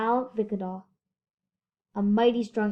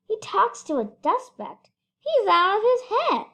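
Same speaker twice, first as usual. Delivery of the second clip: jeering, louder and higher to the end; mocking jeer; 11 semitones higher, 6 dB louder than usual. A narrator's reading. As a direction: sneer at the elephant, building up. Jungle animals mocking Horton.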